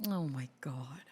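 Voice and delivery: barely voiced, very soft; appalled whisper. Real, not acted. Streamer reacting to the messages, appalled whisper. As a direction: breathe it out under your breath in dismay.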